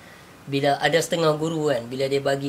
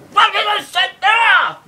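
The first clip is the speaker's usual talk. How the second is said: slow, much higher, loud angry bellow; angry teacher's shout; 12 dB louder, much slower than usual. Retold or imitated. Teacher shouting back at a visiting disciple, angry. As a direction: roar the greeting back to drive him off. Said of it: "Guru dia marah".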